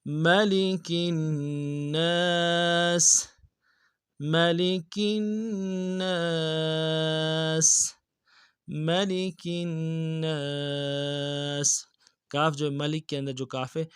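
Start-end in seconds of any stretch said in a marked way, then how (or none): 0.0-11.8 slow melodic tajweed recitation; Quran recitation. Shown as a demonstration. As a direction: chant it slowly with full tajweed. (قرآن کی تلاوت، آہستہ اور سریلی)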